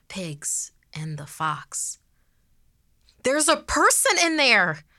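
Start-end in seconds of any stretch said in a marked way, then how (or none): 3.2-4.8 high panicked shout. Acted. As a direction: scream the alarm. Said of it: "someone shouted".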